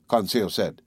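usual speaking voice

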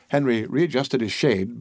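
usual speaking voice

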